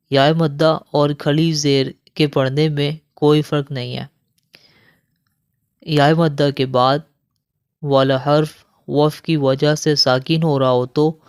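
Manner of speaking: usual speaking voice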